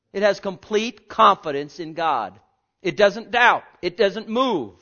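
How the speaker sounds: loud, rising preacher's cry; full-force preaching. Acted. Preacher to his congregation, loud fervent proclaiming.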